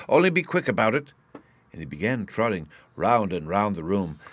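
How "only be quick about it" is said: raised pitch, mildly flustered; a touch of an excited professor; higher, urgent instruction; excited urging. A narrator's reading. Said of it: "shouted the excited professor".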